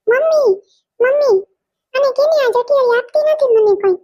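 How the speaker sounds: put-on a tattling kid calling for her mum; whiny child calling mummy. Little girl calls mummy to complain about her cousin.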